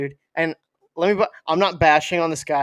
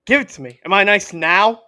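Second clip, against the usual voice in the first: greedy demand; 5 semitones higher, 7 dB louder than usual. Pretend. Greedy customer voiced.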